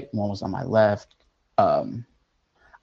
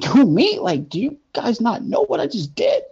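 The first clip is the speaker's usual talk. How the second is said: incredulous; 13 semitones higher, 3 dB louder than usual. Retold or imitated. Shocked disbelief at angels' message.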